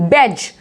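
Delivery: बैज as a plain "bej"; no d heard before the j in बैज; silent d demo. Shown as a demonstration. Teacher correcting to the right form, silent d. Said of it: "डी यहाँ पे silent है".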